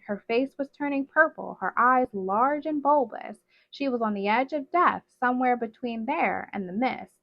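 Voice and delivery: ordinary delivery, nothing marked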